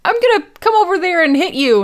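high, loud imitated outrage; hammed-up high-pitched threat; mock threat. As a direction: fling it out high, acting cross. Joan threatens Sherlock.

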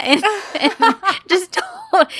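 laughing. Real, not acted. Laughing while retelling.

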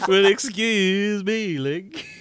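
slow, loud, hammed-up cartoon princess; mock the nineties cartoon princess. Shown as a demonstration. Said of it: "I'm not gonna do it as".

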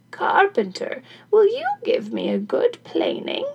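put-on high-pitched old woman's request; eager old crone. Crone asks carpenter for planing, high eager voice. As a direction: climb high as you ask the favour.

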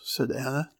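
plain reading voice

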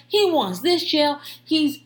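ordinary delivery

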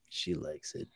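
whispered to himself; a private, smug whisper; inner thought. Retold or imitated. Bruce thinks to himself after the kiss, whispered. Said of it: "just thinks to himself".

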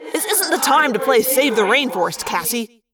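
mildly exasperated scolding; snapping. Acted. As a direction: snap impatiently at a friend. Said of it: "Marco snapped".

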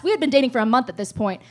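ordinary talk, nothing marked

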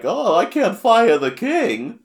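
slow, high, loud cartoon voice; hammed-up drawn-out character impression; Chef Kawasaki. The chef facing the king's pranks, loud high impression. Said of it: "he's like".

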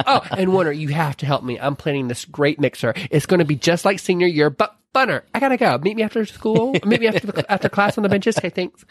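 high, chirpy rushing voice; bubbly Elle. Retold or imitated. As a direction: chatter on, all sparkle. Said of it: "and she's like".

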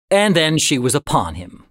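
plain reading voice

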